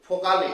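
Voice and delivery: फोकाले said loud and clear, as fo-KAA-le with a long aa; German word demo. Shown as a demonstration. Teacher models the German word फोकाले for students, loud and deliberate. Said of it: "German vowels को बोलते हैं डॉयचे".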